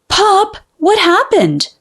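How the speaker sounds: put-on a kid blurting out in alarm; child crying out. A narrator's reading. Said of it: "cried Junior".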